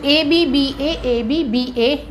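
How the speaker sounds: each letter drawn out; slow letter-by-letter dictation. Shown as a demonstration. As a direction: spell the scheme out slowly for students. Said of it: "teacher repeats the scheme slowly".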